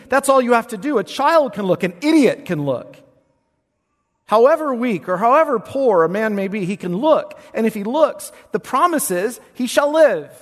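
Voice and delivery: loud, high Baptist revival preaching; hammed-up Baptist pulpit voice; Baptist preaching style. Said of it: "in my best Baptist style".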